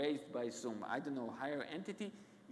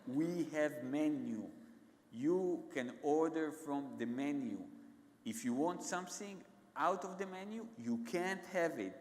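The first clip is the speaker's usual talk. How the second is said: slow, word-by-word retold waiter, patience running out; slow, impatient waiter. Waiter repeating himself to a stoned customer, slow and impatient. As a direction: spell it out slowly, as if for poor English. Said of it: "so I say it again slowly".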